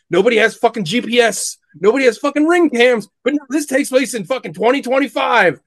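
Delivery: ordinary talk, nothing marked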